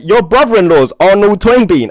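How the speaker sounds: loud, high cry of disbelief; can't believe who he's related to; astonished. Retold or imitated. Shocked at the old man on the plane, loud and high.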